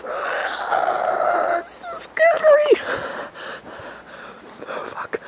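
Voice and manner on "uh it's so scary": much higher, hammed-up wailing sob; fake-cry it in a high, slow, panicked wail; mock terrified crying. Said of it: "I'm really fucking scared right now".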